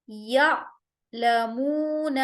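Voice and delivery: یا لمون with a sharp glottal catch on the alif; Qur'anic hamza with a hard stop; hamza with a jolt. Shown as a demonstration. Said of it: "ہم الف کے اوپر آواز کو سختی سے جھٹکا دیں گے".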